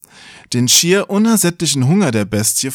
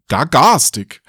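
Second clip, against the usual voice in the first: "Gar garstig" repeated, amused and a bit louder; amused questioning; 2 semitones higher, 3 dB louder than usual. Real, not acted. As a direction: savour "Gar garstig" with a wry grin. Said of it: "Sehr schön".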